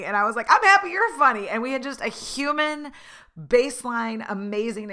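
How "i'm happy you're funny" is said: much higher and louder, bursting with delight; squealing happy comeback; gleeful echo. Retold or imitated. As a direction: throw his compliment right back, thrilled. Gleeful reply to Uber driver.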